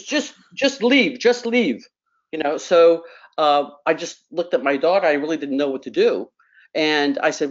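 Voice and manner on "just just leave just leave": put-on high, drawn-out Greek-accented dismissal; mock-exasperated Greek accent, pitched high. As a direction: imitate a Greek father shooing someone off. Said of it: "he says to me in this Greek accent".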